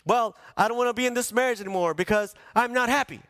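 mock someone quitting a marriage; complaining excuse. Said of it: "the reason that we give".

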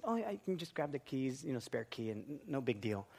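soft, low, forgiving; full of grace. Retold or imitated. Wife forgiving husband's locked-in keys, soft and gracious. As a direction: let it go gently and quietly. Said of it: "full of grace, she said".

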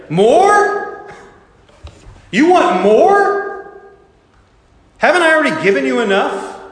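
loud, high, indignant disbelief, drawn out; a scandalised voice refusing the beggar; imagined stern God. Pretend. God as fearful Christians imagine him, loud and indignant. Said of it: "What will God say?".

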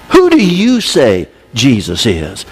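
slow and low, pressing; slow pointed question. Shown as a demonstration. Preacher challenging listeners directly, slow and pointed. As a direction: put the question to them slowly. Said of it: "the important question to you".

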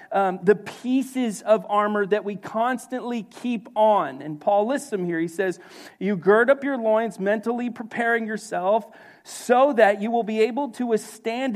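loud, high, driving sermon delivery; fiery pulpit preaching; full-force preaching. Acted. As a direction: preach it out to the whole room.

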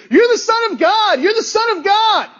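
high-pitched acted shout, repeated urgently; screaming out. Man falling down beside you in crowd, screaming out. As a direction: act out a possessed man's scream. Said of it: "he starts screaming out, trembling".

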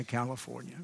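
ordinary talk

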